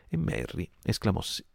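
usual speaking voice

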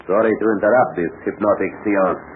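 ordinary delivery

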